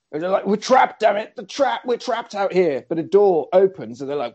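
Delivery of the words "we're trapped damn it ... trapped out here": higher-pitched acted panic; hammed-up alarm; mock panic. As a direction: play trapped punks panicking. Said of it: "The punks panic".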